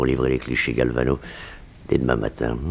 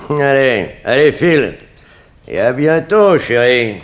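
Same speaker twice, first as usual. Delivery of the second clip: loud, higher, jeering goodbye; mocking send-off; 12 semitones higher, 9 dB louder than usual. Boss sends the girl off mockingly, loud and taunting. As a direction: shoo her out with a taunting laugh in the voice.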